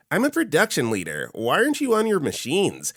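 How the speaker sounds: high pinched nasal whine; nasally voice. Retold or imitated. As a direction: whine it through the nose. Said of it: "the most nasally voice ever saying".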